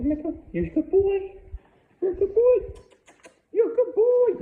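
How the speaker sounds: climbing into a very high, loud squeal; excited baby talk. Shown as a demonstration. Trainer tempting the dog to move, building excited squeal. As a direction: gush at the dog in a squeaky praise voice. Testing stay with excited praise.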